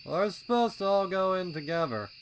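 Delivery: lounging colt laying down the rule; lazy colt voice. A narrator's reading. Snails telling the younger colts to wait, high and loud.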